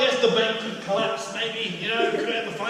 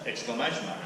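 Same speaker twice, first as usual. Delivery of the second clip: ordinary delivery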